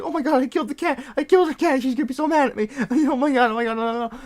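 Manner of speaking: high, loud, hammed-up panic; imitated freak-out. Coworker thinking she killed the cat, mock hysterical panic. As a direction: wail in rapid mock horror. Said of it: "they start freaking out".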